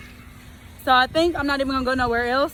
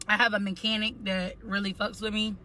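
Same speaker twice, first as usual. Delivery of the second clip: usual speaking voice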